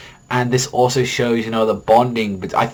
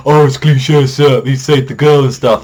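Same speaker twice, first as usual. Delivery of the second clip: louder, higher mocking whine; mock critic; 4 semitones higher, 7 dB louder than usual. Vlogger mocking imagined critics, loud scoffing voice. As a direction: parody the naysayers. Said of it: "people were probably gonna say".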